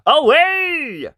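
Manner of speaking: very high, loud, drawn-out shriek; prince's shriek. A narrator's reading. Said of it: "shrieked the prince".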